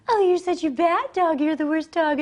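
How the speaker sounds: high cooing mock scold; ineffective dog scolding. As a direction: scold the dog in a high voice it won't take seriously. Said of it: "the dog's not getting it".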